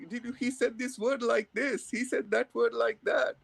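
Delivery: imitating nitpicking listeners, pitched high; high-voiced mock complaint, lightly acted; mock fault-finding. Listeners picking at how the speaker said words, mock fault-finding. Retold audience picking at his accent.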